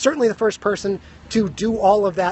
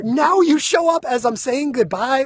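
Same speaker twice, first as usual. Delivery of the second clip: laughing shout; 3 semitones higher than usual. Real, not acted. Teasing a viewer who arrived late.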